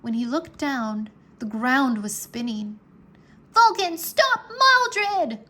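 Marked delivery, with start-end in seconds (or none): 3.5-5.5 high, loud, slow cry; cried out. A narrator's reading. Rory commanding Vulcan, a high loud slow cry. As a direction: call out each word to the dragon. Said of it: "cried Rory".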